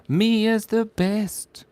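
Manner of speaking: slow high sing-song; high drawn-out praise. Acted. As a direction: stretch it out sweetly.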